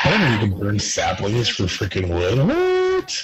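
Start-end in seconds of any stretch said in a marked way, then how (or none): 2.5-3.2 shocked. Real, not acted. Surprised at burning saplings.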